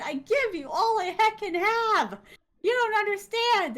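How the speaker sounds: high, drawn-out indignant yell; acted-out yelling. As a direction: act out a heated quarrel, high and loud. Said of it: "they started yelling at each other".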